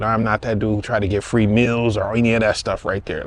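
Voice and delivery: normal talking voice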